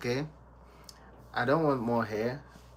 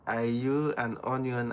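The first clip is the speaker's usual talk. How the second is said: ordinary talk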